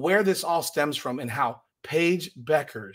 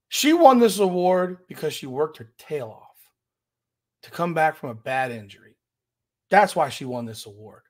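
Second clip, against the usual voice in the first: usual speaking voice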